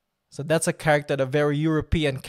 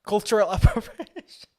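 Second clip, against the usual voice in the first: laughing; 8 semitones higher, 4 dB louder than usual. Real, not acted. Savouring chat's phrase.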